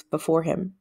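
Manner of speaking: ordinary delivery, nothing marked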